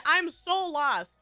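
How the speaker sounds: normal talking voice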